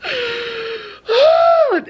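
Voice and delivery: high, loud, breaking cry; really crying "God". Real, not acted. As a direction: let the word break into a sob. Overwhelmed by the book ending.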